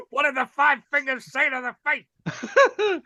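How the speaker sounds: quoted joke through laughter; laughing. Retold or imitated. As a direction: read the line while laughing. Quoting film joke, laughing.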